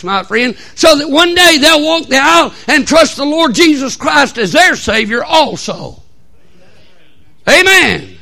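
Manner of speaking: high, loud pulpit shout; full-force preaching. Acted. Preacher urging church about trusting Christ, full-force preaching.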